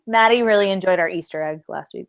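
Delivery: ordinary delivery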